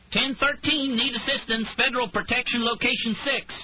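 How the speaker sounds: high, loud frantic radio call; frantic cry. A narrator's reading. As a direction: shout for backup under fire. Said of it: "a man's frantic voice crying".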